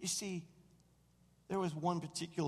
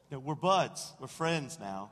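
ordinary talk, nothing marked

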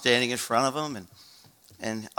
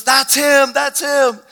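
high, loud excited cries; frantic high-pitched outburst; Judy screaming; 13 semitones higher, 10 dB louder, much slower than usual. Retold or imitated. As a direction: imitate a woman shrieking in recognition. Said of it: "I hear Judy screaming through the phone".

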